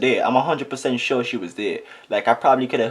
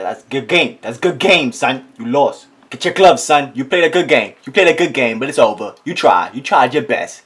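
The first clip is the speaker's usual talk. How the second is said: louder, taunting game-over rant; mock gloating; 3 semitones higher, 9 dB louder than usual. Telling himself he lost, loud taunting gloat. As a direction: rub it in like a sore winner.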